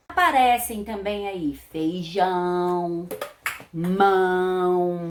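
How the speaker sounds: "feijão" and "mão" with a held nasal "ão"; teaching the nasal diphthong; nasal ão demo. Shown as a demonstration. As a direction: draw out the nasal ending of "mão". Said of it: "O tio também dá um som mais nasal".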